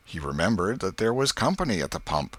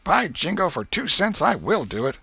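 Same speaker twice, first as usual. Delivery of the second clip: put-on high, loud boyish challenge; a scrappy boy yelling a dare; defiant boy's shout; 11 semitones higher, 4 dB louder than usual. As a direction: fling the dare back at him.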